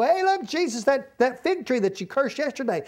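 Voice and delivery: high-pitched, eager calling of attention; acted astonished discovery; excited apostles. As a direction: point it out in amazement, pitch jumping up. Acts out apostles pointing out tree.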